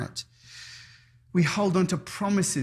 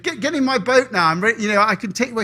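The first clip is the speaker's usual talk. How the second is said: higher, louder rescuer urging; urgent call; 5 semitones higher, 6 dB louder, faster than usual. Acted. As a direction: hurry him into the boat. Policeman urging from boat.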